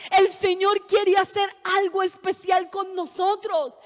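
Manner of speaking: predicando más fuerte, a pleno pulmón; predicación con fuerza. Acted. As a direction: exhorta a la congregación con fuerza. (predicadora exhortando a sus hermanos, con voz fuerte)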